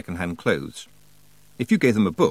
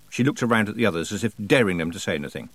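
ordinary delivery, nothing marked

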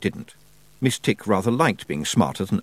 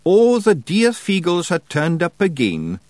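high, slow Scottish wee man reading proudly; Feegle misreading aloud; 9 semitones higher, 4 dB louder, slower than usual. A narrator's reading. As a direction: sound out the wrong words with glee. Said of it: "he said".

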